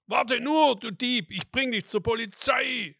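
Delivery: furious high shout at the thief; angry scream. A narrator's reading. Market woman screaming furiously at the apple thief. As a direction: scream at him, loud and furious. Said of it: "schrie sie wütend".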